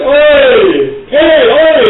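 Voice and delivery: loud, high hawker's call; street peddler shouting. Acted. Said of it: "they're like".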